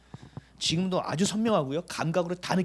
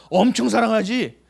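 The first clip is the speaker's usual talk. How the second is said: emphatic reassurance; 5 semitones higher, 9 dB louder, slower than usual. Retold or imitated. Pastor answers in retold dialogue.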